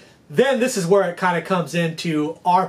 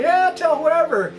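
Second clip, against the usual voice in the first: high-pitched, happy acted reply; eager go-ahead; 10 semitones higher than usual. Fishermen to paying customers asking to tell friends, eager. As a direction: imitate an easygoing yes, pitched well up. Fishermen happily allow spreading word.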